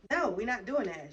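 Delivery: loud firm refusal to the friend. Retold or imitated. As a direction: shut the idea down hard. Refusing friend giving puppy away.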